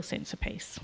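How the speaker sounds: whispering the words of peace; a hushed, moved whisper. Real, not acted. Testimony ends in hushed voice.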